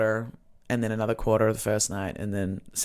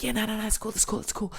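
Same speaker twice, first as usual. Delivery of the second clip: high-pitched, rapid mock assurances; imitated high performers; 9 semitones higher, 4 dB quieter, much faster than usual. High performers assuring the host they will behave, high-pitched imitation. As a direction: imitate eager stoned performers brushing off concerns. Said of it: "They're like".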